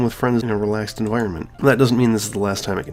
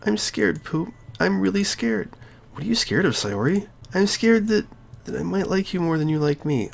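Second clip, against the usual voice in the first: put-on higher, softer frightened girl; scared Sayori; 8 semitones higher, 4 dB quieter than usual. Sayori confessing to her friend, high and soft. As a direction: confess the fear in a small voice.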